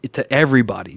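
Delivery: ordinary delivery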